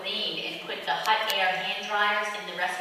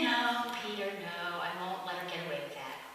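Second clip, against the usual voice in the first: calm Lord; 5 semitones lower, 10 dB quieter than usual. Acted. The Lord answering calmly.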